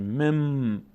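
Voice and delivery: مم with a long closing hum; n turned to m. Shown as a demonstration. Teacher shows the replaced sound, مم with lengthened m. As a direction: hold the last m of مم.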